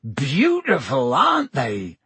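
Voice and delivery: happily admiring, drawn-out first word; Hagrid. A narrator's reading. Hagrid shows off his beloved hippogriffs, happily proud. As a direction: show them off with delight. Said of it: "Hagrid roared happily".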